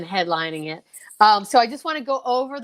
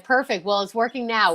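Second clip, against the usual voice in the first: usual speaking voice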